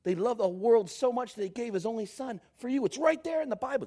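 usual speaking voice

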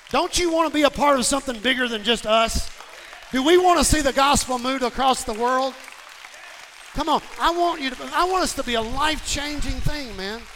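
rally the congregation at full voice; impassioned preaching. Acted.

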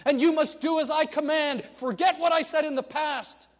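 captain shouting threats with a laugh; furious captain. Retold or imitated. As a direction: bark orders as an enraged captain. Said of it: "And he says".

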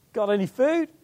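calling out from shore. Retold or imitated. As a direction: call out a friendly question from afar. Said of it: "he shouted out to them and says".

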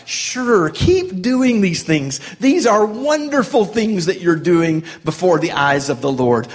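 taunting fake praise; sarcastic taunt. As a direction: pretend to cheer them on, dripping sarcasm. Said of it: "it's said in that tone".

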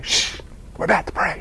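hushed whisper, scolding; whispered shh in church; shushing whisper. Acted. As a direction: hiss it to quiet him down. Shushing the noisy man.

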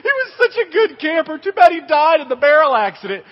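high, tearful mock lament; hammed-up grieving wail; mock mourning. Older campers mourning a buddy at fake graves, mock tearful.

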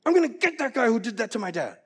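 high loud vow of revenge; angry kid. Retold or imitated. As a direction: shout it like a furious thirteen-year-old. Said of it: "And I was like".

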